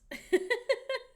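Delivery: bright high burst of laughter; squealing laugh. Real, not acted.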